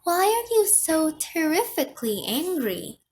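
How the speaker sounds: high, puzzled call; wondering. A narrator's reading. Visitor questions grumpy Ove through the door, wondering. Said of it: "the garage door wondered".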